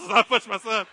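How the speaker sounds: laughing through the words, breaking into a breathy laugh; can't hold the laugh in; real laughter. Real, not acted. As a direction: crack up while telling it. Laughs at his own prank.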